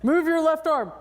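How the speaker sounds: imitating a coach calling out; instructor command.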